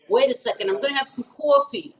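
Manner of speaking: light New York Queens accent, imitating husband; a mild Queens, New York impression of the husband. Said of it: "I'll try to do his accent".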